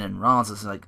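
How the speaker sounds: ordinary talk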